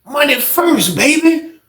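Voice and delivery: high, smug brush-off; smug old head. Retold or imitated. Old head brushing Fred off, smug and high. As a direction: shrug him off with a grin. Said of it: "some old lame, old head shit".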